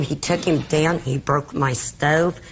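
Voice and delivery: ordinary talk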